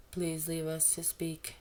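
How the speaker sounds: lightly voiced, slow and calm; a hint of a composed teacher asking politely; deep voice. A narrator's reading. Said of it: "deep, really good voice".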